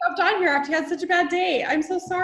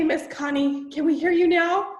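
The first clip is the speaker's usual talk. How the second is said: ordinary talk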